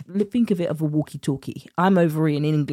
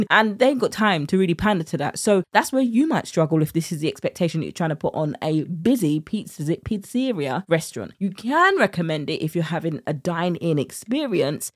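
ordinary talk

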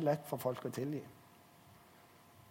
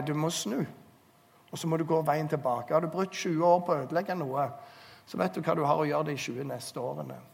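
ordinary talk, nothing marked

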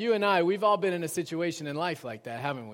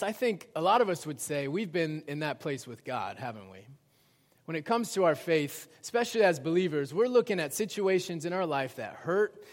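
ordinary talk

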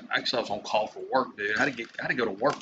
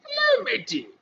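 very high squeaky cartoon mouse; Mickey Mouse. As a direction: squeak a greeting in falsetto. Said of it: "now sound like Mickey Mouse".

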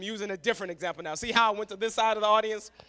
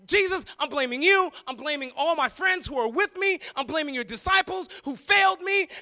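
angry blaming father; 6 semitones higher, 5 dB louder than usual. Acted. Father shouts blame.

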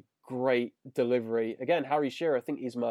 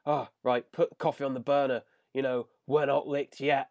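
usual speaking voice

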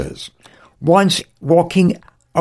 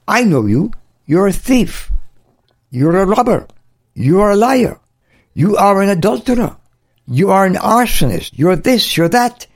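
higher-pitched, mildly hammed-up accusations; accusing devil; 5 semitones higher than usual. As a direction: list each charge like an accuser. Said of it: "devil is going to whisper in your ears".

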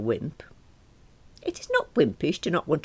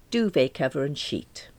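ordinary delivery, nothing marked

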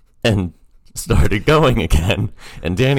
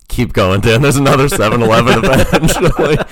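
laughing; 7 semitones higher, 6 dB louder than usual. Retold or imitated. Teasing friend on the phone.

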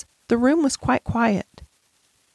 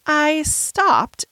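put-on higher-pitched girl's voice; explaining; 7 semitones higher than usual. A narrator's reading. Sarah explaining herself to the headmistress, tentative explaining. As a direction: explain it in a raised child's voice. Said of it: "explained Sarah".

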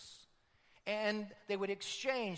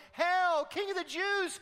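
loud, high mocking hail; mocking soldiers; 11 semitones higher, 7 dB louder than usual. Soldier mocking Jesus, loud high jeer. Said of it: "the next soldier would come and go".

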